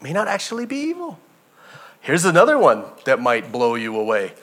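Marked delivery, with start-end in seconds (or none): 0.0-1.2 quiet repeat. Acted. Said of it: "repeated quieter".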